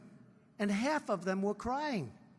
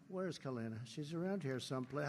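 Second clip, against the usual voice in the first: repeated softer and lower, half to himself; softer, lower repeat of the question; quieter; 6 semitones lower, 9 dB quieter than usual. Real, not acted. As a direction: mutter it again while scanning the room. Repeats while still searching.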